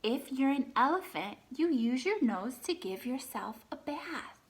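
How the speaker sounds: lively read-aloud, animal names lifted; reading to children. Acted. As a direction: read the book to the class, naming each animal brightly. Teacher reads picture book aloud.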